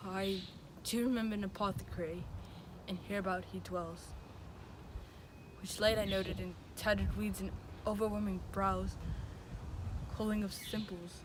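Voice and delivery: soft, brooding lines to himself; subdued soliloquy. Acted. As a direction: think aloud softly, with heavy pauses. Romeo's soliloquy alone, resolving to die.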